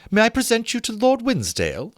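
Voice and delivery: high, sweet society lady; a prim lady making introductions; Miss Montague voice. A narrator's reading.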